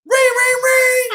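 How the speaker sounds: high, loud ring-ring; doorbell imitation. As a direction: chime it like a doorbell.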